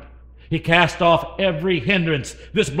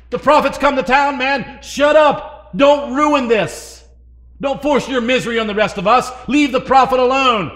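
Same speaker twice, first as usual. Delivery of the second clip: scolding crowd; 8 semitones higher, 6 dB louder than usual. Retold or imitated. Crowd scolding blind beggar.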